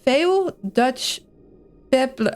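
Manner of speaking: slow, halting English read as Dutch words; Dutch pronunciation. Shown as a demonstration. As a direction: sound the English out word by word in Dutch. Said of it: "I'm pronouncing it more as Dutch words".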